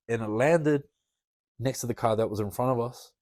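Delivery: normal talking voice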